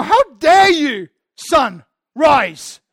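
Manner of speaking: slow, loud, indignant cry at the sunrise; mock outrage at the sun. Preacher mock-scolding the rising sun, loud and slow. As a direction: scold the dawn like a personal insult, drawn out. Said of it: "I'm angry at the dawn".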